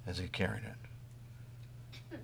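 whispered slowly; hushed whisper. Real, not acted. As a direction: breathe it out in awe. Solemn image of the cross.

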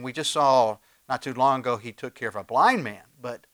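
ordinary talk

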